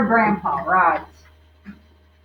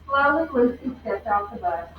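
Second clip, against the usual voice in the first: usual speaking voice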